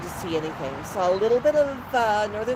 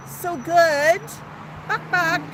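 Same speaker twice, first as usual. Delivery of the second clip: high, loud delighted praise with clucks; praising pet talk; 8 semitones higher, 4 dB louder, slower than usual. Pretend. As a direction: praise a performing bird in clucky baby talk. Praising the bird, clucking along.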